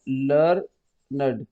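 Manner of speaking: "Learned" drawn out slowly as two beats, लर-नड; syllable by syllable. Shown as a demonstration. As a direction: stretch "learned" slowly so both syllables show. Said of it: "दो syllable है इसमें". ("Learned" को धीरे-धीरे दो हिस्सों में, लर-नड)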